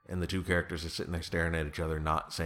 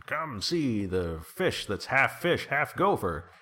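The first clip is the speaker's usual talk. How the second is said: slow, higher-pitched sideshow barker pitch; roadside huckster; 6 semitones higher, 4 dB louder, slower than usual. Acted. Con man luring visitors to his roadside attraction, huckster's pitch. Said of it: "he's like a con man, kind of drifter guy, runs a, a roadside attraction".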